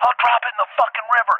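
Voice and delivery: high, fast frantic threat; acted threat. Man holding a baby elephant over a river, acted frantic threat. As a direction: act out a desperate hostage-taker.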